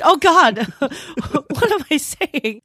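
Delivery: gasp, then laugh it off; laughing embarrassment. Real, not acted. Shocked at herself, laughing.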